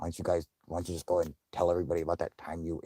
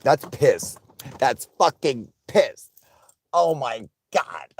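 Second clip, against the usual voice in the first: high, slow, horrified; disgusted realization; 8 semitones higher, 7 dB louder, slower than usual. Retold or imitated. Retelling tasting the liquid, slow high horrified disgust. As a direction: drag it out in dawning disgust. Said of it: "tasted some where I was like".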